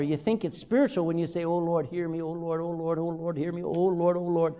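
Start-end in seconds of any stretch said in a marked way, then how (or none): 1.4-4.5 monotone mock pleading, looping without a break; repetitious prayer. Worshipper repeating to sound spiritual, mock droning prayer. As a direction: drone it like an empty chant. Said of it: "saying the same things over and over".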